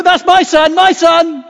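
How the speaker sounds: high, loud shouts of pride; proud cheering dad. Retold or imitated. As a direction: call it out like a father bursting with pride. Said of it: "the one at the back going".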